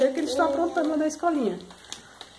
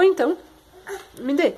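ordinary delivery

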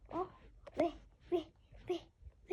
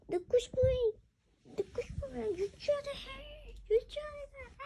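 mock scolding; 10 semitones higher, 4 dB louder than usual. Teacher scolding late student.

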